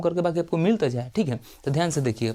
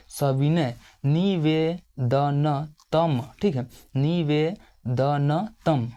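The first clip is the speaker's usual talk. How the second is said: सविनय निवेदनतम drawn out, very slow; slow dictation; much slower than usual. Shown as a demonstration. Teacher dictating the letter's opening to students, very slow. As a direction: spell out निवेदनतम slowly for the class to write. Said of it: "teacher dictates Sanskrit phrase".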